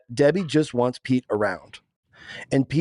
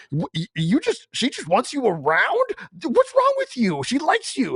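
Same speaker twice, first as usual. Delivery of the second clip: much higher, incredulous mock voice; Seth Rogen impression; 11 semitones higher than usual. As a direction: play the baffled buddy in a raised put-on voice. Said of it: "Where Seth Rogen's like".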